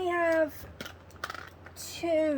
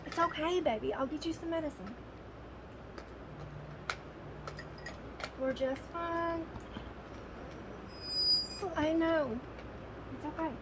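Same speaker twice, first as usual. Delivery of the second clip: soothing the dog; 3 semitones higher, 7 dB quieter than usual. Real, not acted. Owner hushing her scared, shaking dog with medicine promised, soft and soothing. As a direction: gently settle a trembling pet.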